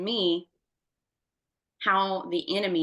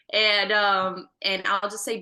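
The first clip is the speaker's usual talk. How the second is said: ordinary delivery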